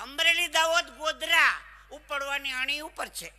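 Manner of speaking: very loud, high bus-stand bellow; bus conductor shouting. Retold or imitated. Conductor calls out the route to passengers, bellowing. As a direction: holler the route across the depot. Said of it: "અમરેલીના બસ stand ઊભો તો".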